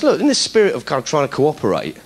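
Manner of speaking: normal talking voice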